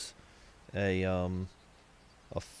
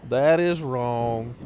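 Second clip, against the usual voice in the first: mock outrage; 7 dB louder, slower than usual.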